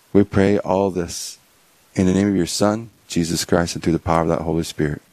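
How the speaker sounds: steady, reverent prayer voice; opening prayer spoken to the Lord. Acted. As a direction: lead the group in prayer. Opening prayer before the talk.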